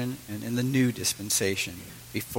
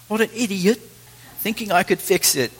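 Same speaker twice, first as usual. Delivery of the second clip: higher, light English accent put on; 8 semitones higher than usual. Preacher imitating Hugo for the congregation, English accent. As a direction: imitate the boy in a clipped English accent, pitched up. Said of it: "He sa-, says it in an English accent".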